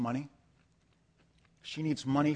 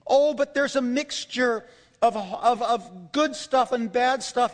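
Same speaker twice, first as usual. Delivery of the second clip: high, loud, slow emphatic sermon delivery; impassioned preacher stretching key words; forceful preaching; 8 semitones higher, 7 dB louder, slower than usual. Real, not acted. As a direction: drive each point home to the congregation.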